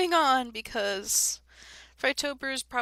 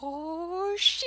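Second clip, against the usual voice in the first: surprised; 8 semitones higher than usual. Real, not acted. Sudden game death.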